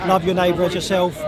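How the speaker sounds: ordinary talk, nothing marked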